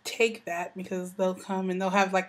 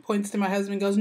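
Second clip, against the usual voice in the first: normal talking voice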